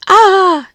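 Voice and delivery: sudden high, loud cry of pain; pained shout. Acted. Boy with chili in his eye, shouting. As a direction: yelp as the chili stings. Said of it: "shouted Louis".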